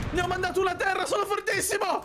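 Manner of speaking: high, thrilled cry of triumph; excited boasting. Real, not acted. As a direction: crow about it in a high voice. Boasts after a kill.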